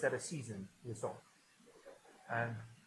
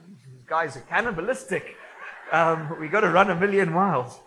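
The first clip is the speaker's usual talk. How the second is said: loud, higher mock alarm; alarmed outsider; 4 semitones higher, 14 dB louder than usual. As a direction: play a horrified newcomer wanting out. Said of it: "Somebody's just like".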